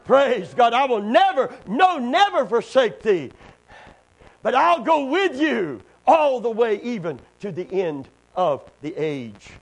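loud, high shouted promise; preaching at full force. Acted. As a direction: proclaim it triumphantly.

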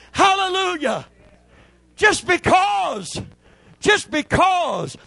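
very high, loud, slow joyful shouts; ecstatic preaching cries. Acted. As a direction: let the praise burst out.

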